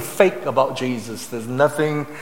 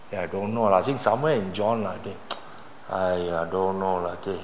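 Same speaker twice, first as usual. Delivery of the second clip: low, soft, unsure churchgoer; hazy, half-hearted recall, dropped low; clueless mumbled answer; 6 semitones lower, 7 dB quieter than usual. Acted. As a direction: fumble for the answer in a low, vague voice. Churchgoer fumbling to recall sermon.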